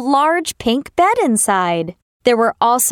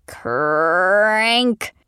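door creak; 3 semitones lower than usual. Acted. Creaking palace door opens.

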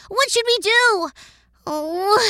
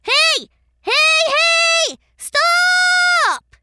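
shouting after the bus; calling out; 5 semitones higher, much slower than usual. Acted. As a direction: yell for the bus to stop. Chasing the departing bus.

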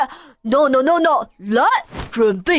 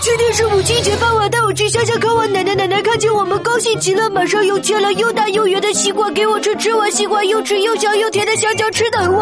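rushed fast without a single pause; all in one breath; faster than usual. Acted. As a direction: race through it before the air runs out. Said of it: "一口气把它说完".

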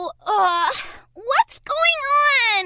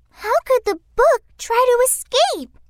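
ordinary delivery, nothing marked

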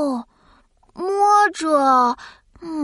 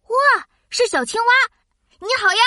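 surprised; 6 semitones higher than usual. Acted. Surprised to meet frog.

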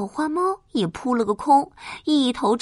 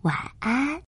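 bedtime goodnight. A narrator's reading.

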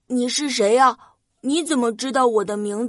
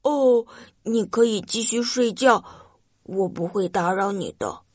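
sad; 3 semitones lower than usual. Acted. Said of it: "红着脸说".

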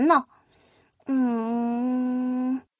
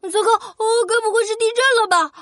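high, fast panicked squeal; much faster than usual. Acted. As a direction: blurt it in sudden fright. Prince fears an earthquake.

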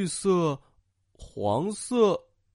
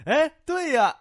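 high, lifted cheerful exclamation; loud character reply; 9 semitones higher, 4 dB louder than usual. A narrator's reading. Vegetable superheroes agreeing together, said out loud. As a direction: call it out high, as story superheroes. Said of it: "蔬菜超人们大声地说".

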